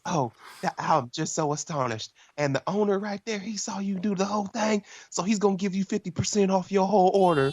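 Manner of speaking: high, astonished Southern belle imitation; mock Southern lady gushing; Southern waitress. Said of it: "She has a really southern accent".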